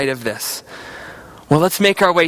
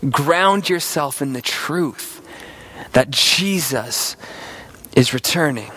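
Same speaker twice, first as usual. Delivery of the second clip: slow tender reassurance; 5 semitones lower, 4 dB quieter than usual. Acted. Closing exhortation, slow and low.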